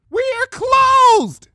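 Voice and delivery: high, loud scream down the hall. Acted. Rarity screams at the early caller, loud scream. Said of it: "she screamed down the hall".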